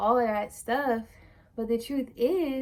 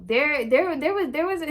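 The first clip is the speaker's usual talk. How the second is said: tripping over the first words, pitched up; stuttering; 5 semitones higher, 4 dB louder than usual. Real, not acted. Vlogger losing the thread while recalling Tanzania, stuttering. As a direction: stammer and restart the phrase. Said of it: "I'm stuttering".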